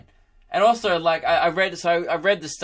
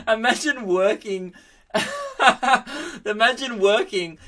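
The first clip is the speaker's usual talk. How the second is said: laughing; 8 semitones higher than usual. Real, not acted. Host cracking up mid-sentence.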